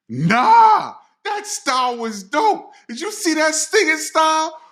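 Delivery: high-pitched, hyped-up rebuttal; imitating excited friend. Bandmate defends the odd performance, imitating excited friend. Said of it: "And Chris was all excited".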